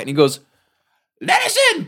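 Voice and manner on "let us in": very high little knight's cry; Didymus voice. Retold or imitated. Didymus at the gate, very high squeaky demand. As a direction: demand entry like a tiny fox knight.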